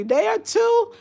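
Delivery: sharp high-pitched disbelief; real surprise at the news; surprised. Real, not acted. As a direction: genuinely caught off guard.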